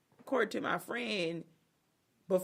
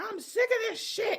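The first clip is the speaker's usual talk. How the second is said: higher, louder mock outburst; mock angry dad; 6 dB louder than usual. Dad fed up before throwing milkshake, hammed-up angry. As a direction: blurt it in a hammed-up fit. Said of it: "he's like".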